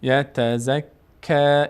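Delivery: "یتزکا" slowly with a light, unrounded long ending; thin light vowel. Shown as a demonstration. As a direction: keep the final "کا" of "یتزکا" long but thin. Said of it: "اینجا هم پر نمیشه".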